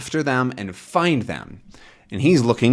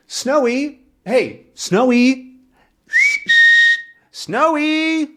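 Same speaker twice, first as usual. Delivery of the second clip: high, drawn-out calls, a bit louder; yelling for Snowy; 5 dB louder, slower than usual. Acted. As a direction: call the dog across a field. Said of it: "Tintin's now yelling".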